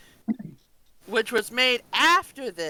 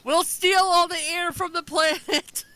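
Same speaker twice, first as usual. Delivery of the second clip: higher, gloating villain voice; sneering panto villain; 8 semitones higher than usual. Retold or imitated. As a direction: gloat like a cartoon baddie. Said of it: "Jeff Bezos will break free".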